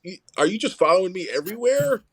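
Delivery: mock-confront someone who keeps turning up; mock exasperation. Coach spots his player in the cafeteria, mock exasperated.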